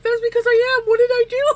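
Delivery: high, loud mock panic; retold scared outburst. Scared driver answering the cop, hammed-up panic. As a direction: wail it in hammed-up fright, about to laugh. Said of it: "you look scared".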